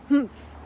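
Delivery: loud gruff grunt; grudging actor's gruff humph. A narrator's reading. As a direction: harrumph grudgingly. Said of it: "he said gruffly".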